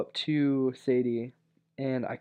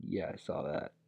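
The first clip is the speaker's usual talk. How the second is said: lower, softer flat reply; a hint of a cold, unimpressed girlfriend; deadpan girlfriend; 8 semitones lower, 8 dB quieter than usual. Girlfriend answering his question, low unimpressed reply. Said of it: "she was like".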